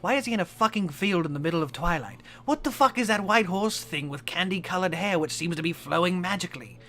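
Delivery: a soldier freaking out in a strange field; confused outburst. Acted. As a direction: fire off the questions in rising disbelief. Doom Guy's confused panicked thoughts.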